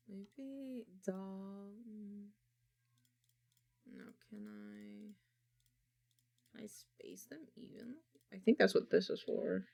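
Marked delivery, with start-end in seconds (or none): 0.1-8.0 whispered to self. Real, not acted. Muttering to self while editing.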